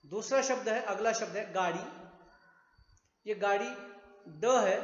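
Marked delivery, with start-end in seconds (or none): none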